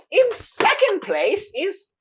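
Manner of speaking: high, loud, slow showman cry; grand announcer. Acted. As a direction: boom it out to the whole arena. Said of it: "He says".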